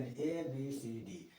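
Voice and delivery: very slow ए बी सी डी, one letter at a time; teacher dictating letters; spelling out letters. Shown as a demonstration. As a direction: spell ए बी सी डी out slowly for the class. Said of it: "एबिसिडेरियन".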